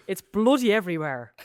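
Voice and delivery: hammed-up Irish brogue on "bloody". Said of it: "I'll do a more Irish accent instead".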